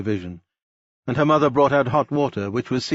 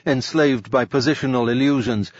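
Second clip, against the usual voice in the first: usual speaking voice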